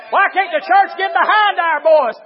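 loud, high, rousing pulpit shout; rally the church with hollered appeals; full-force preaching. Real, not acted.

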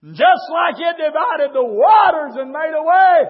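fiery pulpit shouting; full-force preaching. Real, not acted.

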